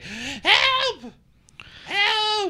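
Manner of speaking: high, thin mock "help!"; high-pitched half-hearted calls for rescue; mock cry for help. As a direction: squeak out an unconvincing cry for help. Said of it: "It just kinda felt just like".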